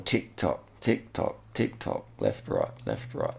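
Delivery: evenly spaced beats, like a metronome; rhythmic ticking. Shown as a demonstration. Commentator mimics the saddle's sway, rhythmic ticking. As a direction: tick it out in time with the swaying.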